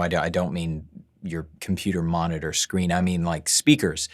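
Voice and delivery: ordinary delivery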